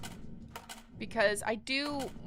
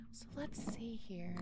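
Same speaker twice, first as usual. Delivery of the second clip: whisper; 11 dB quieter than usual. Real, not acted. Muttering to self while gaming.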